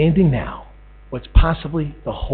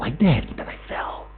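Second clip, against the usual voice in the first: whisper; 9 dB quieter than usual. Real, not acted. Intimate confession to God.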